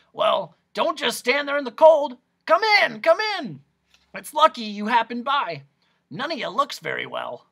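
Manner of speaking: loud, high, hoarse hustling welcome; strange croaking voice. A narrator's reading. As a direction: croak them briskly inside. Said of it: "his strange, croaking way".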